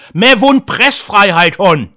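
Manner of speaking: slow, loud, high defiant shout; shouting a slogan in Kassel dialect, slow and loud; rebellious yell in dialect. Retold or imitated. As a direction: bellow it like a nine-year-old rebel. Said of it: "die aufrührerischen Worte brüllte".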